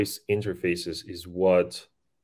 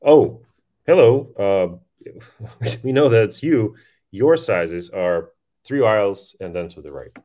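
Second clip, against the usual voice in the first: louder, upbeat shop greeting with a laugh; chatty brand voice; 2 semitones higher, 7 dB louder than usual. Pretend. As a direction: greet the customer like a friendly store. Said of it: "some clothes brand can be like".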